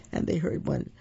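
plain reading voice

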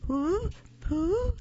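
frightened critter calling out, climbing pitch; panicky little voice. Acted. Raccoon trapped, panicking.

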